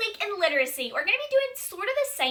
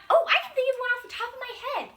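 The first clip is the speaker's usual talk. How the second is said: mock excitement; 9 semitones higher, much faster than usual. Shown as a demonstration. Pretend sudden idea for kids.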